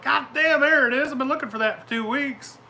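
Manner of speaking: high, surprised cry of discovery; excited high exclaim. Retold or imitated. Boy finding his lost tool, surprised and high. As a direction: sound like a kid who just found it.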